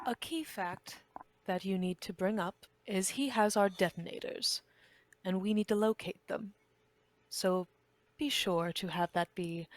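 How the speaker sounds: quiet, controlled instructions from a captor; soft-spoken boss laying down terms; measured interrogator. Acted. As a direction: give orders softly, with deliberate pauses. Player speaks as interrogator Kronz.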